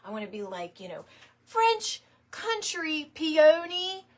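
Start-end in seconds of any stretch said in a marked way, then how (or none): 1.5-4.0 high, slow and grand, louder, savouring the words; fancy-sounding. Retold or imitated. Mocking her own fancy search terms, grand slow voice. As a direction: relish each fancy word like a catalogue. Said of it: "using all these words that nobody's ever gonna search".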